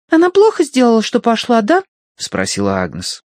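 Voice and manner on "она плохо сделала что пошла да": put-on high, loud child voice; little girl voice. A narrator's reading. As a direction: speak like a demanding little girl.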